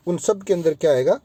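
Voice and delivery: normal talking voice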